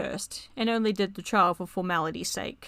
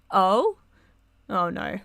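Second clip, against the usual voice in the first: surprised; 7 dB louder, slower than usual. Real, not acted. Reaction to a hinted meeting.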